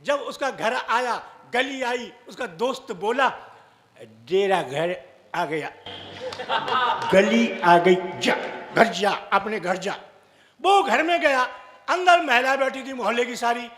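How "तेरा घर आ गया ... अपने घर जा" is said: put-on low, slow drunken slur; drunk friend. Drunk friend steering his pal home, low slow slur. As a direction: slur it like a tipsy friend pointing the way.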